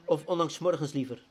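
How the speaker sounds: earnest prayer. Acted. Pastor praying before his small congregation, earnest and pleading. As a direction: pour out the heart in prayer.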